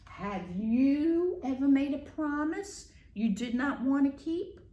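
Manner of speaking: ordinary talk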